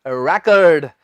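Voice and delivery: "रिकॉर्ड" stressed on REK, reduced ending; noun pronunciation of record. Shown as a demonstration. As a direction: answer firmly with "रिकॉर्ड" as REK-erd. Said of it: "as a noun इसे क्या कहेंगे".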